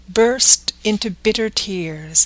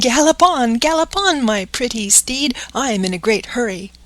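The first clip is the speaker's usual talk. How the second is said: higher, louder cheerful call to the bird; 4 semitones higher, 3 dB louder than usual. Acted. Pinocchio on the pigeon's back, crying out gaily and louder. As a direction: urge the pigeon on like a horse. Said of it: "he cried out gaily".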